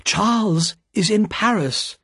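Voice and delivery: put-on high, urgent young woman's plea; anxious cry. A narrator's reading. Lucy bursts in to tell Mister Lorry, distraught. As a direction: blurt the bad news out. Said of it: "cried Lucy".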